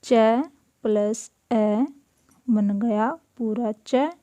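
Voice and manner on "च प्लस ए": slow, each sound separated; slow classroom dictation pace; slow spelling out. Shown as a demonstration. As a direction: build the letter slowly for the class. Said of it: "जैसे".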